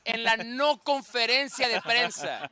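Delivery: slow presenter's intro, "no" set apart; stress on NO. Shown as a demonstration. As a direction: announce it slowly, leaning hard on "no". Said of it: "vamos a presentarlo así".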